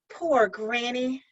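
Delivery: slow, tender pity; sympathetic. Acted. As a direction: say it with gentle pity. Girl speaks sympathetically.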